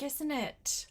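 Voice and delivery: ordinary delivery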